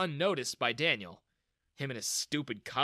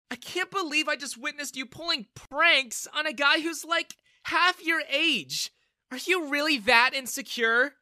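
scornful scolding; 13 semitones higher, 7 dB louder than usual. A narrator's reading. Daughter scolds her father for the prank, scornful. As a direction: shame him with every word.

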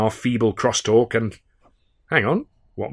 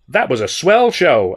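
American shout; 5 semitones higher, 5 dB louder, slower than usual. A narrator's reading. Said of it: "he shouted above the din".

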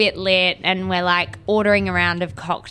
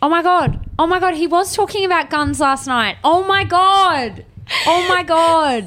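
higher, louder, stretched oh my god; exasperated oh my god; 8 semitones higher, 4 dB louder than usual. Real, not acted. Friend recalling Jarch's gun talk, exasperated disbelief. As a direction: groan it out in disbelief.

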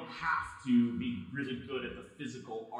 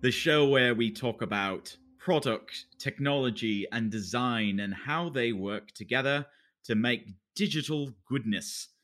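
put-on British accent; 8 dB louder than usual. Host welcomes listeners and introduces co-host, British accent. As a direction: introduce the show in a loud mock British accent. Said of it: "I'm talking in a British accent".

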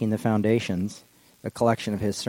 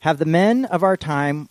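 solemn quote reading; 7 semitones higher, 7 dB louder, slower than usual. Acted. Starts Tillich quote.

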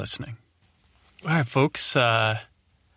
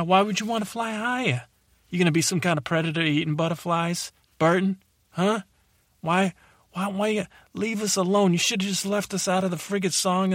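higher-pitched aggrieved New York whine; indignant protest; 7 semitones higher than usual. Acted. Butterfly telling Burton to leave us alone, indignant. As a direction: plead and complain, pitch climbing.

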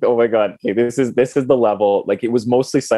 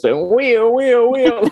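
high playful siren wail; ambulance siren noise; 14 semitones higher, 3 dB louder, slower than usual. Retold or imitated. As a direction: mimic a toy ambulance siren.

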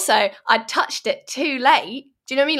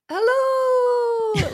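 high, drawn-out Scottish hello; Scottish accent. Retold or imitated. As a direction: stretch hello in a Scottish lilt. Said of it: "like that".

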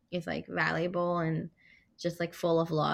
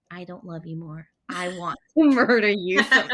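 laughing mock threat; 8 dB louder than usual. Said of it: "I was like".

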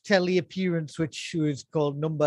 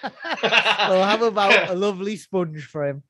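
chirpy mock presenter through a laugh; a touch of a cheery craft presenter; laughing presenter impression; 3 dB louder, slower than usual. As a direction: pitch the sponge frame like a kids' host. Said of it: "his introduction to that".

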